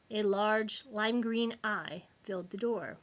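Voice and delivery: plain reading voice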